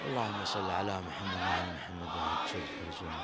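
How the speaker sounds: crowd chanting the blessing aloud; loud salawat. Real, not acted. Congregation answers the preacher's call with a loud chant. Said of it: "فصلوا بصوت عال إن شاء الله".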